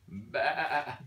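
low, wavering playful bleat; sheep bleat. Pretend. Sheep sound in book recap.

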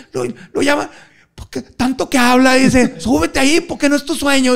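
high, laughing pal voice; mock friend pitched high; imitating an insistent friend. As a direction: push him hard, half joking. Friend urging him up.

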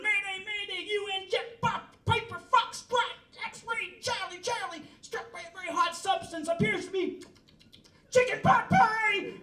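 a pilot yelling high over the radio; panicked pilot mayday. Acted.

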